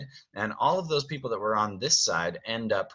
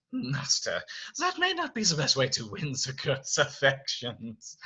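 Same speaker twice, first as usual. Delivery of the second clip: put-on raised, accented servant voice; Lumiere; 4 semitones higher than usual. Servant advising his master about the girl, tactful accent. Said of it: "then Lumiere says".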